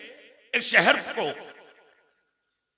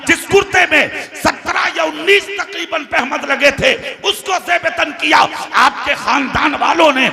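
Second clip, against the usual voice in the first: high, forceful sermon delivery; impassioned preaching; 6 semitones higher, 9 dB louder than usual. Real, not acted. Preacher telling a congregation the story of Umar's shirt, impassioned. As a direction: drive each phrase out to the crowd.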